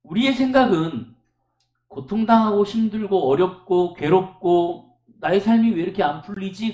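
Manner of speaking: ordinary delivery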